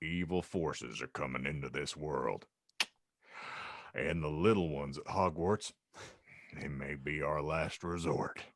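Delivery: an ominous warning from Hagrid; grave. Acted. Hagrid warns the table over casserole, low grave voice. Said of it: "Hagrid pronounced gravely".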